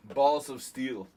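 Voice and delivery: loud Russian-Georgian accent impression; Russian-Georgian New York accent. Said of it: "Georgian accent".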